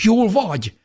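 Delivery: high, loud worried question; alarmed cry. A narrator's reading. Child checks on injured mother, alarmed cry. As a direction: call out anxiously, pitched high. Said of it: "kiáltottam".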